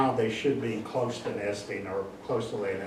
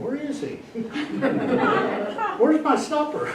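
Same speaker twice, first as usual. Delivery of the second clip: voicing hungry owl Dexter; 14 semitones higher than usual. Pet owl demanding supper, high laughing voice. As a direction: squeak it out like an impatient little owl. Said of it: "he's watching the sun go down going".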